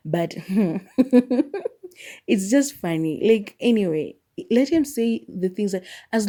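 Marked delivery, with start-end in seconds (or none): 0.4-2.2 high chuckle trailing upward; light run of giggles; amused chuckle. Real, not acted. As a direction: let out a real, rising giggle. Amused by his English.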